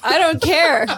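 loud high I don't care; defiant shout. Real, not acted. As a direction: blurt it out, defiant. Defiant outburst.

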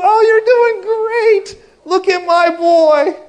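very high, very loud, slow gushing cry; proud mom crying over her boy. Retold or imitated. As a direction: gush over him like a proud mother. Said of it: "you know, Mom".

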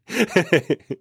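a burst of genuine high laughter; real laugh. Real, not acted. Laughs at his own story.

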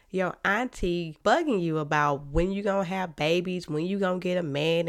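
normal talking voice

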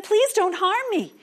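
high, loud frightened plea; pleading. Acted. Nisam begging the swooping eagles, high and frightened. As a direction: beg the eagles not to hurt him. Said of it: "he said again".

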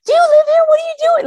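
put-on very high, loud childlike squeal; shrill-pitched mock kid chatter; excited kids. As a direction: imitate a crowd of thrilled schoolchildren. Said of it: "they're all excited".

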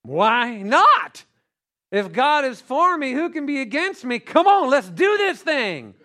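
rally yourself to charge into it; high-energy, fearless challenge; bold eagerness. Acted. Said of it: "we have to have more of a".

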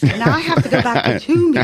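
fast, loud, laughing complaint; laughing exasperation. Real, not acted. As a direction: blurt it out through laughter.

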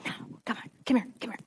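breathy unvoiced coaxing whisper; enemy whispering. The enemy tempting a student, whispering softly. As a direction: lure them close in a hush. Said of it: "He can come up to you any moment and say".

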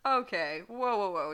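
loud whoa, backing away; yelling. Acted. Draco yells, shoving Edward away, loud. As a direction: shout to hold him off. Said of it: "Draco yelled".

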